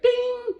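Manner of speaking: very high, loud sung-out ding; imitated bell. Telling how the judges' bell rang, imitated ding. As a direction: ring out like a contest bell. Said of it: "all of a sudden the bell said".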